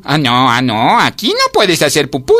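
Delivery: loud, high refusal; scandalised ant putting a foot down; offended. A narrator's reading. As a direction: stretch the words in affront. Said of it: "dijo la hormiga ofendida".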